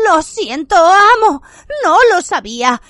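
high, loud pleading whimper. Acted. Bellatrix kneeling and apologizing to Voldemort, whimpering. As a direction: beg her master. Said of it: "gimoteó Bellatrix".

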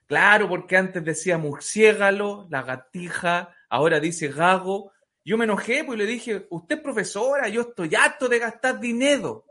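high, fed-up rant with faulty R; comic outraged parent rant; acted indignant parent. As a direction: complain heatedly, mispronouncing every R.

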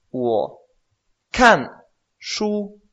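"wo kan shu" with "kan" loud and high, pauses between syllables; slow Mandarin model. Shown as a demonstration. Teacher modelling a sentence slowly for a student. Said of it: "Por ejemplo".

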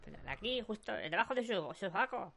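small, muffled voice from under the armpit. Acted. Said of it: "¿De dónde viene esa voz?". (voz ahogada desde debajo del sobaco)

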